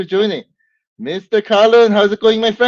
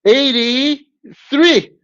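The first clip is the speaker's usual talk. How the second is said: "Eighty-three" stretched very slowly, higher and louder; drawn-out correction; 8 semitones higher, 5 dB louder, much slower than usual. Shown as a demonstration. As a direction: announce "Eighty-three" slowly like a host's reveal.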